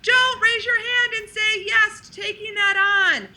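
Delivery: a high insistent inner voice telling the truth; inner wisdom voice. Retold or imitated. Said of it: "inner wisdom who's saying".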